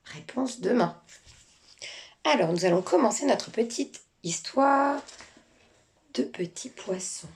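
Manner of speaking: ordinary delivery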